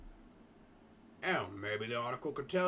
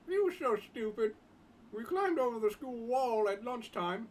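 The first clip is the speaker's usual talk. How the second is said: put-on higher, slower boy's voice; schoolboy Jim; 8 semitones higher than usual. Boy Jim telling the others about his school, higher boyish voice. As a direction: lift the voice for a polite teenage schoolboy.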